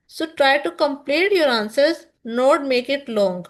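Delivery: very slow with a rise on complete; slow and emphatic. Shown as a demonstration. Teacher instructing students on answers, slow emphatic delivery. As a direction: drive the advice home word by word. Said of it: "teacher gives instruction".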